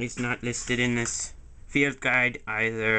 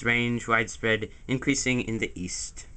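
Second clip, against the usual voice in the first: usual speaking voice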